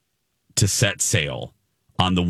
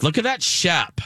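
much higher gushing imitation; imitating influencer; 10 semitones higher than usual. Host repeats the influencer's line to co-host, high mock gush. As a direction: toss off the ditzy ship line. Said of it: "Annalyn McCord or whatever".

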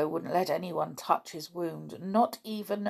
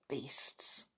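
muttered; 4 semitones lower, 10 dB quieter than usual. Acted. Nobby curses the captors.

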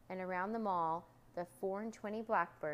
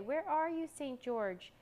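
raised, searching call; 7 semitones higher, 4 dB louder, slower than usual. Acted. Boy looking for Saint George, raised searching call. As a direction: call out high, easing down on the name. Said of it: "called the boy".

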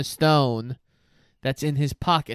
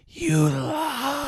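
high, eerie accusing voice; disembodied voice; 11 semitones higher than usual. Retold or imitated. Disembodied voice catching Quirrell lying, eerie and high. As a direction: hiss the accusation like a ghost. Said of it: "the disembodied voice goes".

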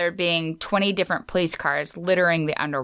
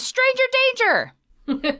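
high yelled Stranger then a drop on danger; Jake yelling. Retold or imitated. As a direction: yell it as Jake being carried off. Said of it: "he goes".

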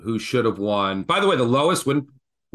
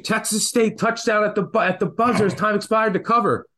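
higher mock yell, rushing the news; retold excited scream; 9 semitones higher than usual. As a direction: act out the friend announcing a buzzer-beater.

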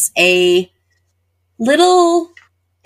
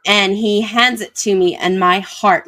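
ordinary talk

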